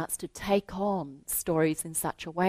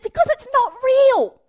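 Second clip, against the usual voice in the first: put-on much higher, louder child's blurt; insistent boy Jack; 14 dB louder than usual. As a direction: blurt it out like an eager kid. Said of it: "He said".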